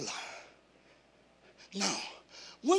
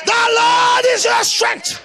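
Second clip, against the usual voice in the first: much higher, louder and slow, stretched out; climactic shout; 13 semitones higher, 16 dB louder, slower than usual. Acted. Preacher to congregation, the loudest slow climactic shout. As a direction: hit the peak and hold every word. Repeated, bigger declaration.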